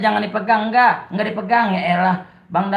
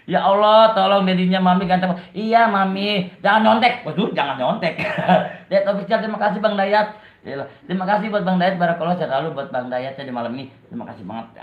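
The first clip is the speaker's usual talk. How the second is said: ordinary delivery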